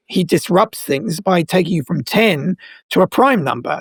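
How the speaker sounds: normal talking voice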